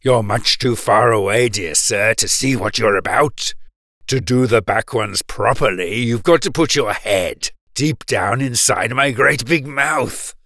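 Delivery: grinning crocodile tempting the dentist into his mouth; coaxing crocodile. A narrator's reading. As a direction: lure him in, low and sweetly sinister. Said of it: "the crocodile called out".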